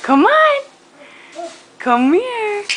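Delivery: high, loud sing-song coaxing. Real, not acted. Coaxing baby to crawl.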